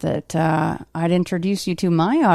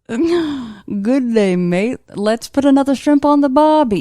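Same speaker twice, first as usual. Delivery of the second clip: hammed-up Aussie accent, pitched up; over-the-top mock Australian twang; terrible Australian accent; 8 semitones higher, 4 dB louder, slower than usual. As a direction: badly fake an Australian, building louder. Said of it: "a terrible Australian accent".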